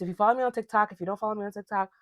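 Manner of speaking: ordinary delivery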